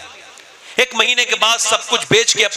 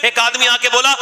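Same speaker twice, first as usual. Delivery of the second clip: ordinary delivery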